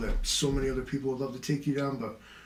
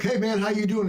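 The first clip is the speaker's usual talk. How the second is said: higher, louder mock greeting; mock cheery greeting; 9 semitones higher, 5 dB louder than usual. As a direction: greet a buddy over-brightly. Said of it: "you're like".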